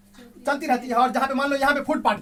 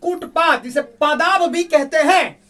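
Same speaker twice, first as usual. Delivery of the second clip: emphatic; 5 semitones higher, 9 dB louder than usual. Real, not acted. Teacher emphasising key term to class.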